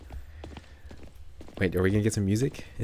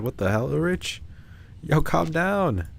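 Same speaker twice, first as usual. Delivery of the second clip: surprised; 9 semitones higher, 6 dB louder, slower than usual. Real, not acted. Reacting to the outburst.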